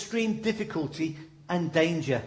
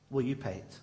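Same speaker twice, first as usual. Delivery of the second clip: softer each time, dropping low; quiet repeated question; 6 semitones lower, 9 dB quieter than usual. Real, not acted. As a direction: ask it hushed, letting it sink in. Preacher repeats question softly.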